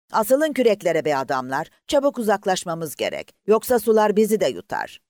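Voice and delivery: loud, rising urgent orders; urgent commands. Acted. Officer urging the rowers to pull away before the suction, urgent shout.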